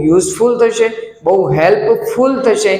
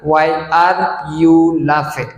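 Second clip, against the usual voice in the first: stretched slow teacher read; very slow repeat; 3 semitones lower, much slower than usual. Shown as a demonstration. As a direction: say it slowly.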